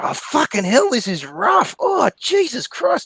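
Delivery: high, strained cry of shock; hammed-up agony. Joke-teller voices customer finding sex rough, hammed-up pained cry. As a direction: wail it in exaggerated discomfort.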